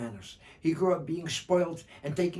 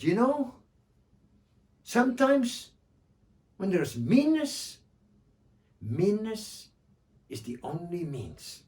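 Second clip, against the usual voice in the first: sly moral; 6 semitones higher, 5 dB louder, slower than usual. Acted. Joha speaks to the dog.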